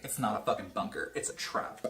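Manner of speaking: quiet. Retold or imitated. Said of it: "they were like".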